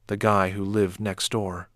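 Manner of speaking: ordinary delivery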